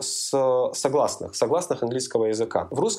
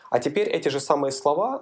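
ordinary delivery

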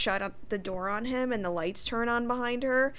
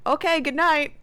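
raised, chipper mock-polite sign-off; polite retreat; 6 semitones higher, 6 dB louder than usual. Sean giving up at the door, politely. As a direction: call out an obliging goodbye, a bit louder. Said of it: "at least he's polite".

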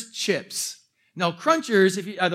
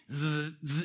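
mosquito buzz; 5 semitones lower, 8 dB quieter than usual. Retold or imitated.

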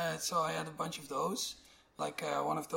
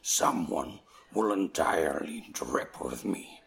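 very low, louder beat-poet growl with whispered bits; [name] voice; 9 semitones lower, 5 dB louder than usual. Retold or imitated. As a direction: drop way down and growl the poem slowly. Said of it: "In [name]' voice".

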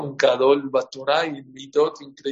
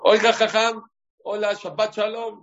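mocking driver; 8 semitones higher than usual. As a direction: taunt the rabbi cheerfully. Mocking driver calls to rabbi. (conductor burlón llamando al rabino, agudo)

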